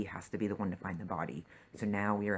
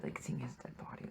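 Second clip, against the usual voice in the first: whispered, much lower; 10 semitones lower, 8 dB quieter than usual. Real, not acted. Viewer whispers about seeing the dead body, low and hushed.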